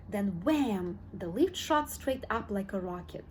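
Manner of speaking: plain reading voice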